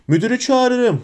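high-pitched shout at contained volume; shouted threat. A narrator's reading. McGonagall threatens to call the headmaster, high contained shout. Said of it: "diye bağırdı Profesör McGonagall".

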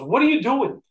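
a father yelling at his son over soccer; angry father. Retold or imitated.

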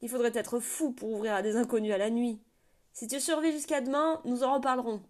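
loud gatekeeper turning them away; shouting down. A narrator's reading. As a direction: yell it down from the wall. Sentry shouting down from wall.

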